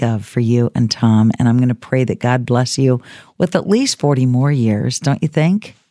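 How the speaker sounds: gently touched sincerity; tender. Real, not acted. Host thanks caller tenderly.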